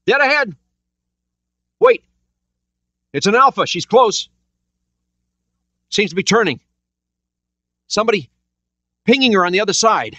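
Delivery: high, urgent sonar operator calling contacts; alarmed call out the contact fast; excited sonar call. A narrator's reading. Sonar man warning the captain, high and urgent. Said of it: "Jones sang out".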